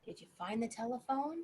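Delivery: usual speaking voice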